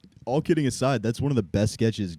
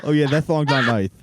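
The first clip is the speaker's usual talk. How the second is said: ordinary talk, nothing marked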